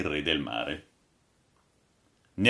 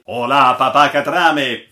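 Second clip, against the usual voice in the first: loud, raised hail; interrupting call; 5 semitones higher, 11 dB louder than usual. A narrator's reading. Captain interrupts the old sailor's tale, calling out loudly. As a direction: cut in forcefully on the speaker. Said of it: "disse il capitano interrompendo".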